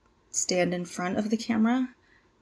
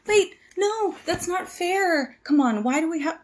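much higher, with a pleading whine on "that's not fair"; upset protest; 8 semitones higher than usual. Acted. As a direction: protest the unfairness, rising high in pitch. Protests having to restart.

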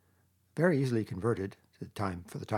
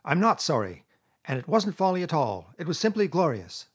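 raised, louder defiance; sullen but stout; 6 semitones higher, 7 dB louder than usual. A narrator's reading. Toad refusing to repent before friends, stoutly defiant. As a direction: stand your ground stubbornly. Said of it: "a little sullenly but stoutly".

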